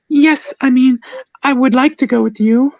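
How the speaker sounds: very high, eager character voice; eager girlfriend. Acted. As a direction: jump in eagerly in a squeaky high voice. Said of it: "I giggled at her eagerness".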